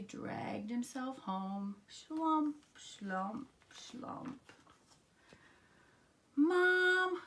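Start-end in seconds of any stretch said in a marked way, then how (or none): 1.9-4.5 fading, deflated slumps; dejected dragging. A narrator's reading. Froggy dragging himself home, words fading dejectedly. 6.4-7.2 high, stretched whine for mom; crying out. A narrator's reading. Froggy home disappointed, high whiny cry to his mother. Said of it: "cried Froggy".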